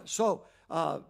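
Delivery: ordinary talk, nothing marked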